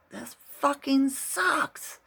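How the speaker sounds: hammed-up scream of frustration; retold scream. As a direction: belt it out like finally letting the anger go. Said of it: "Scream it".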